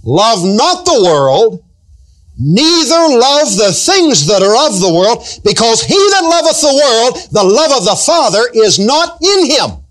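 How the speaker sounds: slow, lofty recitation of the verse; solemn scripture reading. Acted. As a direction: intone the Bible verse with weight.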